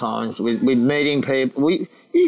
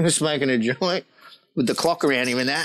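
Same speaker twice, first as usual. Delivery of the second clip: ordinary talk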